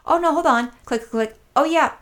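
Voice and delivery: high-pitched imitation of a clerk checking; retold store clerk. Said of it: "She's like".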